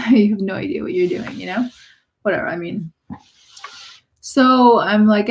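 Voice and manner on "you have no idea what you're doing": scoffing with a laugh; laughing retort. Retold or imitated. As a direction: laugh it off, half disbelieving. Laughing retort at able-bodied parkers.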